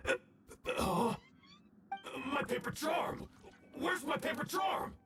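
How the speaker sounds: scramble, realizing it's gone; panicked search. Acted. Villain realizing his detonator charm is missing, panicked.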